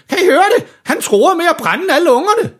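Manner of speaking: very high, panicky Santa shout; alarmeret råb. A narrator's reading. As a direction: call everyone to witness, voice way up. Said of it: "råber julemanden". (skinger, forskrækket råben)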